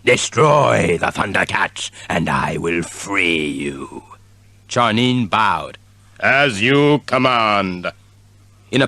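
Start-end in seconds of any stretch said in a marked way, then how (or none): 0.1-4.0 villain voice. A narrator's reading. Villain commanding. 6.2-7.9 genie voice. A narrator's reading.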